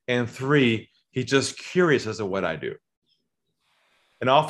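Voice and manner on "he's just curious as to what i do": normal talking voice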